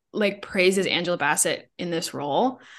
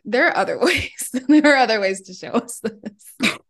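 louder, laughing through the words; laughing complaint; 3 semitones higher, 5 dB louder than usual. Real, not acted. Host objecting to the film's love scene, laughing in protest. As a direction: protest while cracking up.

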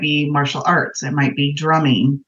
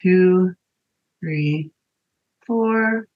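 slow breath counting; 4 semitones higher, much slower than usual. Shown as a demonstration. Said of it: "okay, ready?".

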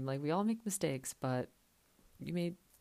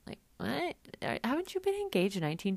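incredulous; 8 semitones higher, 4 dB louder than usual. Real, not acted. Ranting about Danielle to listeners, shocked high question. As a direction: pitch shoots way up in disbelief.

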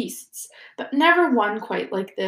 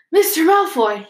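raised, urgent call, a little breathless; mild gasp; 8 semitones higher, 5 dB louder than usual. A narrator's reading. Harry catching up with Malfoy on the stairs, gasping call. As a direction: call out higher and louder, as if just arrived. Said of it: "he gasped".

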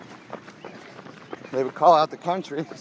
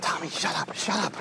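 hushed mom; 3 semitones higher, 8 dB quieter than usual. Retold or imitated. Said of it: "mom's reply".

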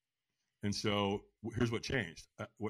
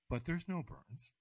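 usual speaking voice

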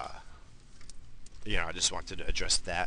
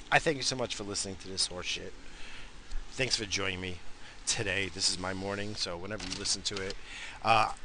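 ordinary delivery

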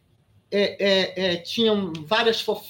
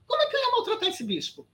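high-pitched disbelieving question; incredulous protest; 12 semitones higher than usual. Real, not acted. Guest denies mistreating the bishop, incredulous. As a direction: protest how impossible it is, pitch leaping up. Indignant denial of rumour.

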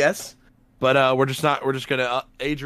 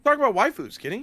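excited; 8 semitones higher than usual. Real, not acted. Teasing call to Kenny.

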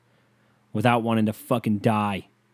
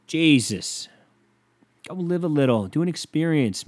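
exasperated rant; 6 semitones higher, slower than usual. Real, not acted. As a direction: throw the words at them, fed up. Ranting at stingy people.

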